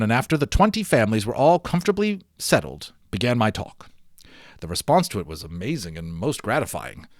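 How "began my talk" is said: ordinary delivery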